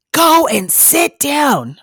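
slow, high, loud bellowed order; drawn-out shouted command; imitated nurse's yell. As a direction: yell it like a stern order across the room. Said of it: "I hear this nurse yell".

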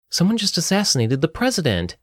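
high-pitched yell held at normal volume; understated narrated yell, high; mild yell. A narrator's reading. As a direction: raise the pitch as if yelling urgent news. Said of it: "Kayla yelled".

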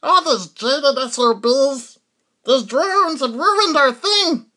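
very slow, high alien grumble. Acted. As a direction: drag it out like a sulking alien. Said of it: "It's my alien impression".

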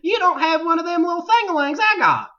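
high hillbilly twang. Retold or imitated. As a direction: tease like a backwoods kid. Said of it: "The redneck voiceover".

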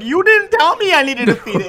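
loud, high-pitched retold comeback; hammed-up defensive whine at a parent; mock indignant protest. Retold quarrel with dad.

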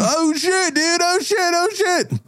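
high squealing repeated cries; hammed-up terrified partygoer; mock panic.

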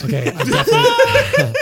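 high, loud, laughing through it. Real, not acted. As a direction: crack up while trying to answer. Laughing at the question.

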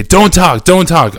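barked order. Retold or imitated. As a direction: imitate a guard cutting someone off. Acted guard silencing him.

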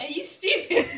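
mock outrage. Sister to complaining sibling, loudly mock-outraged. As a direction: fire back in a pretend quarrel. Acted quarrel over a hurt foot.

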